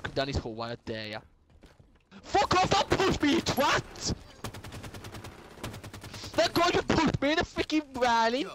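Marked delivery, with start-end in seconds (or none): 2.2-4.1 high, drawn-out angry protest; indignant outburst. Real, not acted. Telling a player not to push, high indignant yell. Scolding a player who pushes him. 6.4-8.5 high-pitched outraged protest; indignant complaint. Real, not acted. Complaining to a mate about being pushed, indignant.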